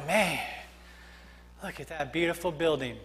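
low, soft awe, acted; hushed admiration. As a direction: imitate an onlooker admiring quietly. Imagined admirers outside a church.

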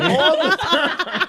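drawn-out, high, loud laugh-talk; slow high laughing. Real, not acted. Telling friends the stepdad grilled often, laughing high and loud. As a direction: stretch it out through laughter.

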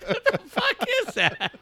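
very high, laughing bewilderment; mock bafflement in a squeal; squealed disbelief. Acted reaction amid laughter.

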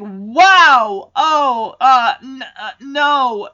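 loud, startled stammer of a shocked dad. Retold or imitated. Dad shocked at daughter's outfit.

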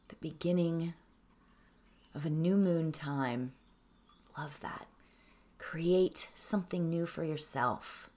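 slow, hushed meditation voice; soft words with long, restful pauses; guided meditation. Acted. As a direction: lead them gently into calm, trailing to near-whisper. Leads a breathing meditation.

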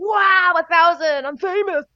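high, loud excited squeal of words; hammed-up thrill at sudden fame; mock ecstatic. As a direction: burst out high and overjoyed, sending yourself up. Re-enacting joy at first thousand.

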